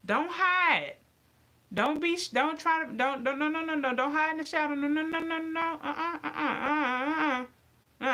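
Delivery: scolding taunt. Acted. Scolding hidden racists.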